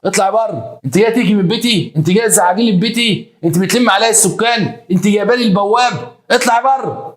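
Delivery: high, heated scolding of an intruder; indignant eviction. Retold or imitated. Wife throws the intruder out of her home, high indignant scolding. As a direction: pile up accusations, pitched high.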